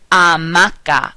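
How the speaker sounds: slow Spanish "hamaca", starting on the vowel; silent H. Shown as a demonstration. Teacher modelling cognates for practice, silent H in "hamaca". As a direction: say "hamaca" with no H, as "ah-MAH-ka". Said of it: "H in Spanish is always silent".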